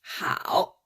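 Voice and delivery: "hao" drawn out low and creaky; slow teaching "hao", dipped low; third-tone hao. Shown as a demonstration. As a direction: demonstrate "hao" with a long, low dipping tone. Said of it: "It's the third tone. This sounds like".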